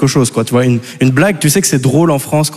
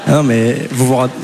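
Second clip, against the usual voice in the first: ordinary talk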